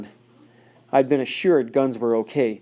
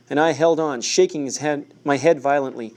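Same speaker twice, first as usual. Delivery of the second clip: ordinary delivery, nothing marked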